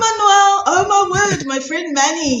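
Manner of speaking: high, bright gushing greeting; airy gushing darling tone. Real, not acted. Greeting online friend, gushing.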